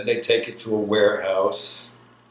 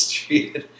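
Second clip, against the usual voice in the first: normal talking voice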